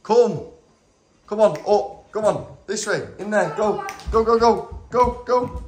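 high, repeated short calls; urging the dog on. Real, not acted. Hurrying the stubborn dog along, high urgent coaxing. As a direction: chivvy her along in a high voice.

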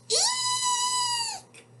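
high, drawn-out squeal of fright; scared shriek. A narrator's reading. Cowboy breaks off his lullaby in fear, high shriek. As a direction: squeal "eek" in storybook terror. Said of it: "He's scared again".

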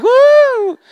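high, loud fangirl "woo"; mock scream. Comic shows how guys react to Enrique, mock scream. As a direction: imitate a guy squealing like a fan. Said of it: "guys will scream like".

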